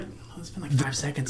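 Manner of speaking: whispered, out of breath; mock breathless old man. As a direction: gasp it out in a whisper. Mocking Harrison Ford.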